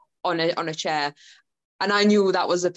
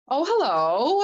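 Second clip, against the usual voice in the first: unfazed greeting; 8 semitones higher, much slower than usual. Retold or imitated. Calm greeting to scary actor.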